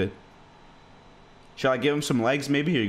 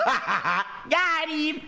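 laughing through the words; cackling at the prank; 11 semitones higher than usual. Real, not acted.

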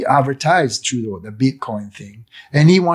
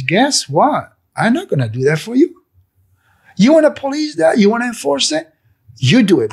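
high, loud defiant challenge; defiant retort; 11 semitones higher, 5 dB louder than usual. Retold or imitated. Retelling his defiance of the mandate, a loud defiant challenge. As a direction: throw the enforcement back at them.

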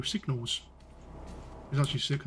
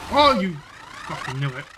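sudden angry outburst; 5 dB louder than usual. Real, not acted.

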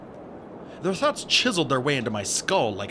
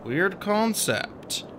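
muttered remark; 6 semitones higher, much slower than usual. A narrator's reading. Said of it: "I muttered".

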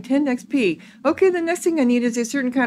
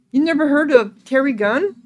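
lightly voiced ghost hunter, higher and questioning; mild incredulity; 5 semitones higher, 4 dB louder than usual. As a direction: ask as if mildly astonished. Character is amazed at the question.

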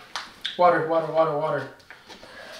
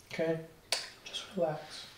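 soft soothing; 6 semitones lower, 21 dB quieter than usual. Real, not acted. Calming partner.

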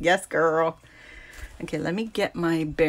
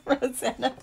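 ordinary talk, nothing marked

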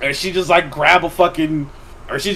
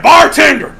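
calling out; 9 semitones higher, 8 dB louder than usual. Retold or imitated. Calling the bartender in a bar.

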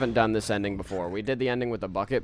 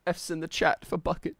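high, loud, choked-up tribute; hammed-up grieving memorial; halting, overcome pretend mourner; mock eulogy; 7 semitones higher, 8 dB louder than usual. Mock eulogy for lost bucket.

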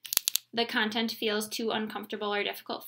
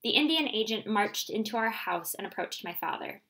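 plain reading voice

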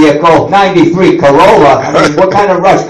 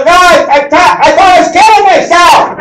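high, flustered yelling; hammed-up panicky explanation; mock yelling; 11 semitones higher than usual. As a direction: yell in embarrassed protest. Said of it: "yelling through the window".

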